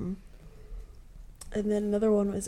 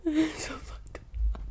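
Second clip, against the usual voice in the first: laughing whisper; 7 semitones higher, 4 dB quieter, much slower than usual. Real, not acted. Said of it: "laughing at the story".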